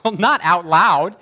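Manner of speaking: slow, loud and emphatic; drawn out, firmly correcting her. Retold or imitated. The preacher correcting the girl, slow and loud. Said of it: "I said".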